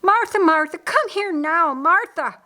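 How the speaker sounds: high, loud urgent call; calling for help. A narrator's reading. Mary calls Martha to her sick brother, urgent cry. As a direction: cry out for your sister to come. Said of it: "Mary called for help".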